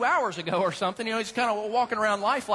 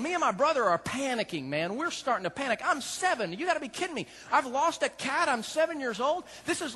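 ordinary delivery

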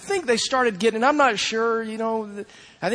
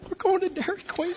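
put-on much higher, laughing child's cheer; gleeful kid; 8 semitones higher, 4 dB quieter than usual. Excited son cheering from the bottom of the stairs, laughing. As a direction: cheer like a kid who just won.

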